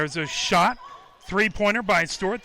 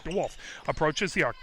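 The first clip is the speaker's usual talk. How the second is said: usual speaking voice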